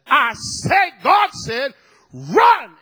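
explosive, high-pitched preacher's shout; thundering, full-force acted command. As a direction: bellow it as an order to the fallen man. Acted command to a dead man.

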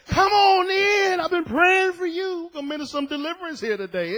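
high, loud, shouted greeting; preacher welcoming Jehovah's Witnesses at the door; excited welcome. Pretend. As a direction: throw the door open with a shout. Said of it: "I'm gonna say".

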